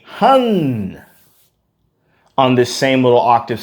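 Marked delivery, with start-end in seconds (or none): 0.1-1.0 hun sung a bit higher with the tongue behind the teeth; vocal exercise hun. Shown as a demonstration. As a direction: sing out a resonant hun. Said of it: "is going to be doing a".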